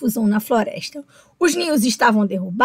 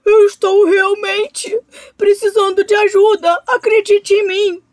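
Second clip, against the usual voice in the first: higher, pushed pleading with great effort; straining plea; 8 semitones higher, 3 dB louder than usual. A narrator's reading. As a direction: force the words out, begging. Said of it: "exclamou o jacaré num esforço tremendo".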